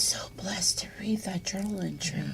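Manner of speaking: soft, unvoiced whisper; moved daughter recalling mother's journal; whispered awe. Real, not acted. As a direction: breathe it out in reverence. Reverent memory of mother's journal.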